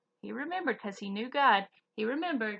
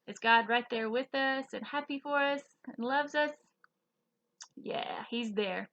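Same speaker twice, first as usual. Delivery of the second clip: usual speaking voice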